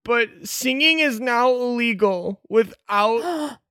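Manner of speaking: high, formal proclaiming voice; town crier. Acted. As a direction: read the decree like a herald. Crier announces new law.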